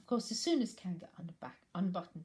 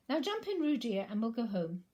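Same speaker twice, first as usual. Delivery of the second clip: high, louder fond call; mother reining in her child; Kanga calling; 5 semitones higher, 7 dB louder than usual. Acted. Kanga calls Roo.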